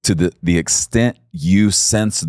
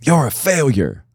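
higher, sneering accusing voice; enemy's taunt; 8 semitones higher than usual. Retold or imitated. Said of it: "his enemy does".

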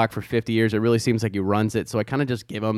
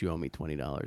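low, much softer serious threat; lower, softer mock menace; 10 semitones lower, 17 dB quieter, faster than usual. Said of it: "a very serious look on".

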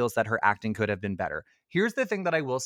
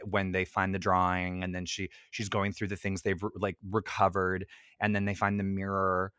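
usual speaking voice

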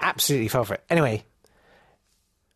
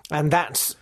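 usual speaking voice

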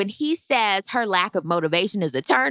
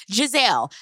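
ordinary delivery

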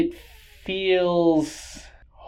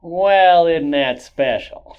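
a hint of Dana Carvey's Church Lady; sweet southern shade; Church Lady impression; 5 semitones higher, 6 dB louder, slower than usual. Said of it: "that's my church lady impression".

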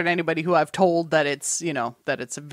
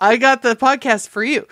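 higher, louder sales pitch, finger guns out; salesy pitch; 7 semitones higher, 7 dB louder than usual. Retold or imitated. Pitching the queer sci-fi podcast to friends, cheesy salesman. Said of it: "You probably can hear it in my voice".

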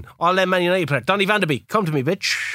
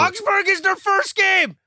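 high, drawn-out excited cry; 12 semitones higher than usual. Real, not acted. Manager spotting a first fixture, excited. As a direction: call out the find in high excitement. Sees the fixtures, exclaims gleefully.

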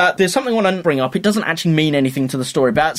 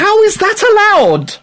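high, loud disbelief; incredulous; 6 dB louder than usual. Real, not acted. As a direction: ask in shocked outrage. Outraged at offensive line.

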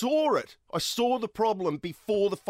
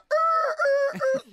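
very high, loud electronic beeping; repeated shrill alarm tone, loud; imitated smoke alarm beeps; 5 dB louder than usual. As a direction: beep out the smoke detector noise. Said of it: "from the smoke detector".

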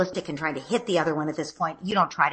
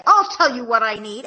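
put-on louder, higher snippy kid; 4 semitones higher, 7 dB louder than usual. Son snapping at his sister with attitude. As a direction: say it with attitude like a sulky boy. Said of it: "that tone of voice".

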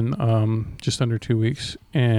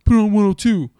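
higher, louder bro demand; pushy passenger; 6 semitones higher, 5 dB louder than usual. Retold or imitated. Boyfriend demanding the radio station, loud high bro voice. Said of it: "he would go".

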